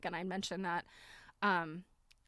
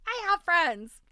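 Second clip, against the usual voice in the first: high, loud, drawn-out protest; mock indignant protest; 8 semitones higher, 10 dB louder, slower than usual. Wife answering husband's teasing about friends, mock indignant. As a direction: protest it slowly and loudly, playfully offended.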